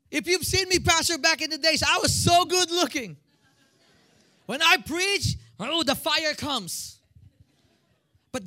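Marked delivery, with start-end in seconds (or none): 0.1-7.0 lightly voiced higher-pitched boaster; boastful church member. Church member boasts to pastor about old days, boastful. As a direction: brag excitedly about the old days, high and animated.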